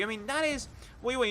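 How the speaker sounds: normal talking voice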